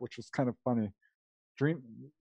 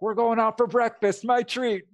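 giddy excitement; 8 semitones higher, 3 dB louder than usual. Retold or imitated. Excitedly calling brother after dream.

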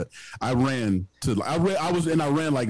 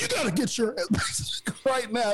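high urgent guard voice; 11 semitones higher than usual. Retold or imitated. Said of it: "He's like".

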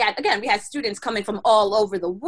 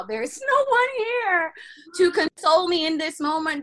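mock distress; 6 semitones higher than usual. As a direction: lament it as a hammed-up cry. Retold self-pity lying on ground.

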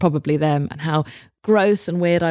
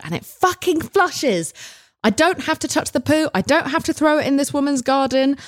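elated relief; 8 semitones higher than usual. Real, not acted. Telling the audience the toilet flushed, in high elated relief. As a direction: let the relief burst out high.

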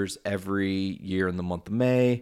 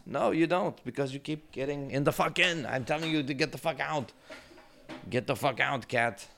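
high-pitched exasperated telling-off; scolding the cat; 7 semitones higher than usual. Real, not acted.

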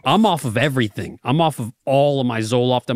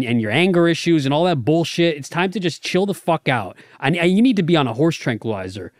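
usual speaking voice

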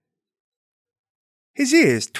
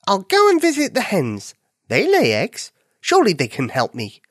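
high bunny voice; 5 semitones higher than usual. Acted. Bunny plans aloud.

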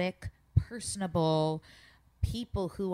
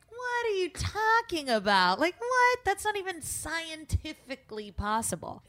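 what are you talking about, rising in disbelief; incredulous outburst; 12 semitones higher than usual. Retold or imitated. Retold outrage at false accusation.

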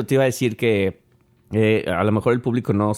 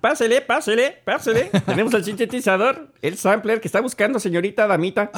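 high singsong street vendor; singsong market price calls; 10 semitones higher than usual. Pretend. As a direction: call out to shoppers like a market seller. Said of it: "meterme a algún mercado".

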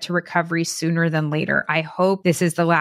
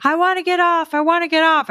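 high, louder whining plea; mock whiny plea; 10 semitones higher, 3 dB louder than usual. Mocking the addict begging as they rush by, high whine. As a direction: mimic a pleading addict, pitched way up.

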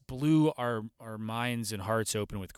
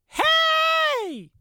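put-on high, loud little boy shouting hey; imitating a small boy yelling from his yard; child's yell; 10 dB louder than usual. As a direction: call out like an excited kid across the yard. Child yells at them from yard.

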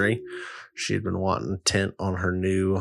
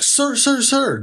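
high-pitched pleading calls, much higher; mock frantic calling; slower than usual. Guy chasing him down calling out in a high frantic voice. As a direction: squeak out an urgent sir sir sir.

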